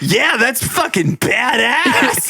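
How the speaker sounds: high, slow, shouted hype; hyped shout. Retold or imitated. Host mimicking Wolverine fans, shouted high and slow. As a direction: yell it like a stoked fan. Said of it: "Just like".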